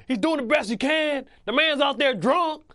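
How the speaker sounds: high, loud hammed-up coach pep talk; rally the team like an overexcited coach; imitating coach Singletary. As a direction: coach firing up a losing team at halftime.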